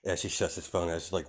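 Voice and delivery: ordinary delivery, nothing marked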